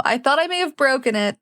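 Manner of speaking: ordinary delivery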